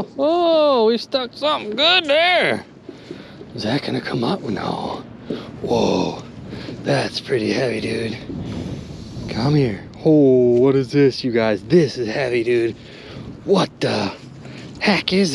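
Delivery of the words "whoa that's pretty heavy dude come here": ordinary delivery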